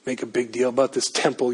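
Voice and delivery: low, quiet, tough dismissal; tough mocking voice. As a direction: scoff at the temple. Stephen's tough retort.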